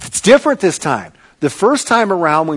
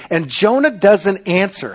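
ordinary talk

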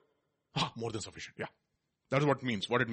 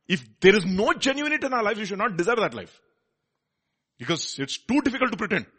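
forceful preaching; 8 semitones higher, 6 dB louder than usual. Real, not acted.

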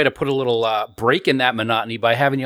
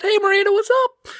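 excited greeting. Real, not acted. Welcoming guest on air.

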